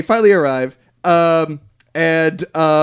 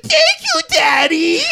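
piercing, very high mock little-girl squeal; much slower than usual. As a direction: shriek it as a horrifyingly cutesy impression. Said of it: "That's a horrifying impression".

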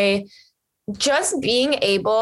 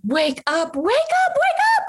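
rising, frantic, higher and higher wake-ups; shaking him awake, voice climbing; excited wake-up call. Retold or imitated. Telling her boyfriend to wake up, frantically excited.

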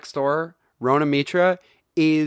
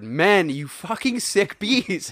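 slow, hammy outraged insult; 6 semitones higher, slower than usual. Pretend. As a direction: drag out the insult in disgust. Mock rant scolding men.

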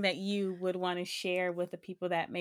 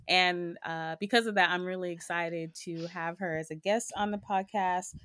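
ordinary talk, nothing marked

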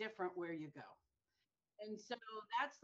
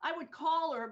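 ordinary talk, nothing marked

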